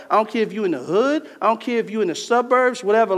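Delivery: loud, rising preacher's climax; preaching at full force. Acted. Preacher fires up the congregation about redeeming time, loud and rising.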